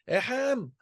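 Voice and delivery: insistent ahem. Retold or imitated. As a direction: clear the throat a third time, pressing. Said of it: "in a voice".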